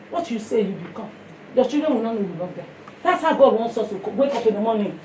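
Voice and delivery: usual speaking voice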